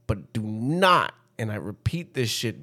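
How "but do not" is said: "do not" slowed right down, long NOT; emphatic warning. Retold or imitated. Warning friend off his bread, "not" heavily drawn out. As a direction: draw out "not" as a firm warning.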